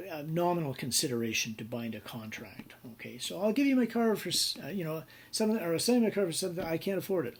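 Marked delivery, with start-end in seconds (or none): 3.4-7.4 mock haggling. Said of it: "acted buyer and seller".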